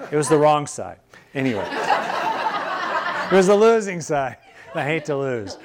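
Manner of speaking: laughing through the words, stretched out; laughing at herself. Real, not acted. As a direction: chuckle as you own up to losing. Confessing losing the argument.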